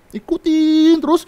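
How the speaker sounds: high, slow, loud nagging; mimicking the pestering girl, irritated; annoyed mimic. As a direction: drag it out mockingly. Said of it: "nyebelin emangnya".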